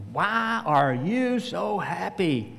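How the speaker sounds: slow, lightly imitated Russian accent. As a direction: stretch the words in a mild Russian accent. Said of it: "he said in his Russian accent".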